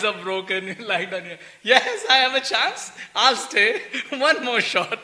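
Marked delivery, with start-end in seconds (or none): 1.6-5.0 slow, laughing mock hope; deluded hope. Teacher mocking the soul's clinging to hope, slow and laughing. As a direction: chuckle out a hopeless last chance.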